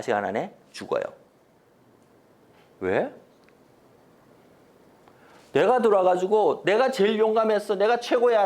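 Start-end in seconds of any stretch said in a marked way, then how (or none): 5.5-8.4 louder, higher, proud bragging; crowing about your own feat; boastful general. Acted. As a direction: brag loudly that you were the bravest. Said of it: "라고 이야기하는 순간에".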